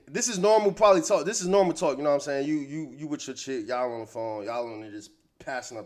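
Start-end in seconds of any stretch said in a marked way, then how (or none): none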